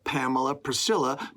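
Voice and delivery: ordinary delivery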